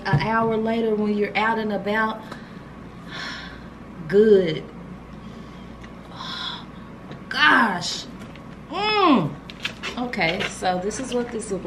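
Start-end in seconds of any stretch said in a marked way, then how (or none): 2.2-3.7 soft breathy sigh of pleasure. Real, not acted. Savoring the scent, a soft sigh of pleasure. 6.1-6.8 short breathy sigh of pleasure; soft sigh. Real, not acted. Savoring the scent. 8.7-9.3 high, rising-falling hum of delight; pleased hum. Real, not acted. Savoring the scent, a high pleased hum.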